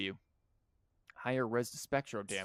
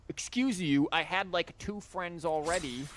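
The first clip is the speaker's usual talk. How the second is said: indignant, raised pitch and louder; mock offended; 6 semitones higher, 4 dB louder than usual. Real, not acted. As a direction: act mock-insulted. Mock offended at friend count.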